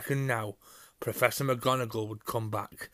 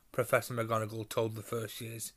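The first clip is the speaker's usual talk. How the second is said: ordinary delivery, nothing marked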